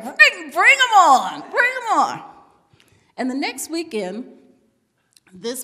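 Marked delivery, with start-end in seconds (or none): 0.0-2.2 loud, slow, drawn-out dare; eager challenge. Retold or imitated. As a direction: call it out boldly to the universe. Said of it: "I said".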